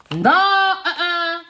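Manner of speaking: high, slow, loud no-no; drawn-out refusal. Real, not acted. She refuses ever to see that woman again, drawn-out high uh-uh. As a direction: stretch the refusal out, shaking it off.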